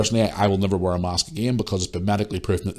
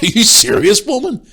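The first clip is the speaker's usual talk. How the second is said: loud, high-pitched exclamation of outrage; retold outburst, voice jumping up high; indignant disbelief; 14 semitones higher, 7 dB louder than usual. Ranting to listeners about a rude woman, indignant.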